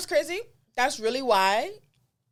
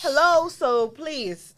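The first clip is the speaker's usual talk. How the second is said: sassy; 4 semitones higher, 5 dB louder, much slower than usual. Real, not acted. Sassy dismissal.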